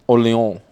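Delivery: "Orleans" as "Or-lee-oh"; Orleans said the French way. Shown as a demonstration. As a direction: drop the final s from "Orleans". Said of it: "they're certainly not saying".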